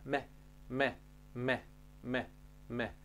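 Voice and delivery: "me" with lips simply meeting, softly; soft repeated "me", lips just touching; light lip-touch M. Shown as a demonstration. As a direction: say each "me" softly and separately. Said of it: "Deci se ating buzele".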